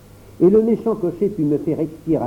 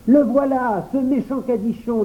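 put-on high child voice, accusing; a boy denouncing the donkey; 9 semitones higher than usual. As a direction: point him out like an angry boy. Pierre's child voice.

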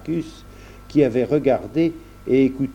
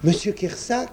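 joyful exclamation; 10 semitones higher than usual. A narrator's reading. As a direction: exclaim at wonderful news. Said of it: "s'écria Jean".

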